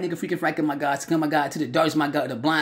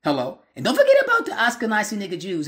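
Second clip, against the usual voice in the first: ordinary talk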